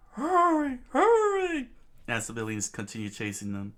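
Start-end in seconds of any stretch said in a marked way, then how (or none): 0.1-1.7 high, loud frantic yell; screaming. Acted. Usopp urging Vivi to run, high frantic scream. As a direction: shriek it, far up and loud. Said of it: "Usopp is screaming at Vivi".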